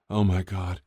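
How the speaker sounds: ordinary delivery, nothing marked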